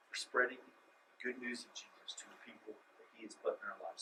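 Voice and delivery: hushed, reassuring whisper. Acted. Pastor whispering assurance to nervous believers. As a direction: whisper the comfort close.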